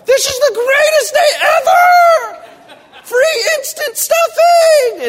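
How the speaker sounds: very high, slow, loud squealing dog voice; happy dog voice. Pretend. Dogs lapping up spilled stuffing, voiced in a very high, slow, loud squeal. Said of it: "They're like".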